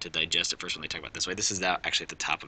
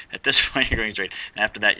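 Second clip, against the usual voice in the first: louder, with a laugh on "this"; laughing correction; 3 semitones higher, 9 dB louder than usual. Real, not acted. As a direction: fix the slip with a laugh and push "this" out firmly. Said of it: "Sorry, what did I say?".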